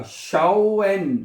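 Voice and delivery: शाओएन stretched slowly as "shao-en"; AU read as "ao". Shown as a demonstration. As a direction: draw out the आओ glide in शाओएन. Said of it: "उसको आपने आओ पढ़ना है".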